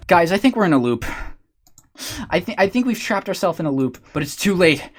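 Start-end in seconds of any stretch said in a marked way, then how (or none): none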